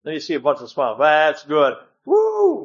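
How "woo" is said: a sudden, much higher happy whoop; excited whoop. Real, not acted. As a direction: cheer the room on. Cheering the smiling congregation.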